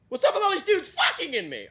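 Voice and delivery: very high-pitched, squeaky indignant bush; talking bush. Acted. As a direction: play an outraged bush in a high silly voice. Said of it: "my impression of a bush in a public park".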